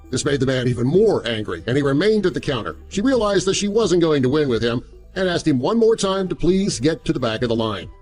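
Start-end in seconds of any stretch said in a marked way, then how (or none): none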